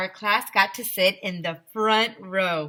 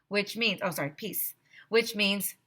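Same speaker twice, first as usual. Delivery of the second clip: usual speaking voice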